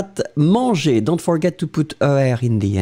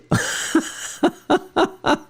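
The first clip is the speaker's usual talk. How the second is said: high unvoiced giggle; breathy laugh; 14 semitones higher, 3 dB quieter than usual. Real, not acted. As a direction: burst out laughing softly. Surprised laugh at student.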